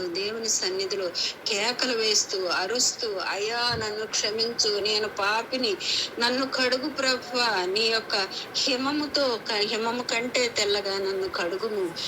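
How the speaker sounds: ordinary talk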